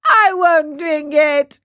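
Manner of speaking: loud, slow tearful wail; drawn-out childish crying refusal; bursting out crying. A narrator's reading. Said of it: "bursting out crying".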